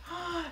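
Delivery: high, soft intake of wonder; surprised gasp. Real, not acted. Reacting to homemade glitter, surprised gasp. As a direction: gasp as if just discovering it.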